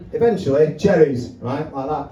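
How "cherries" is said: ordinary delivery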